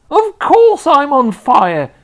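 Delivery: slow, high, loud indignant yell. A narrator's reading. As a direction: snap back at the boy, yelling. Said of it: "she yelled".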